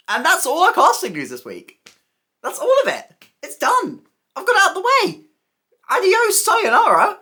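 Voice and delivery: high, loud and exclamatory; giddy sign-off. Real, not acted. Host wrapping up the casting news, giddy high-pitched sign-off. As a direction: pitch it way up, rising to a loud farewell.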